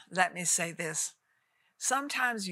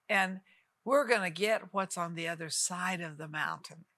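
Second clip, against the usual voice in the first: usual speaking voice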